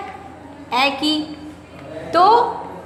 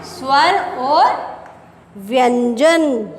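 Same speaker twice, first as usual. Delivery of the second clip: very slow, stretched स्वर और व्यंजन; dictating stretched out for note-taking; slow dictation; 4 semitones lower, much slower than usual. Shown as a demonstration. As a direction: draw out स्वर और व्यंजन so the class can write. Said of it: "dictating heading slowly".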